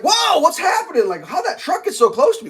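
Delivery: sudden high alarmed whoa, then baffled; retold shock at a truck too close; startled whoa. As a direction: jolt of surprise at the truck. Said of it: "I'm like".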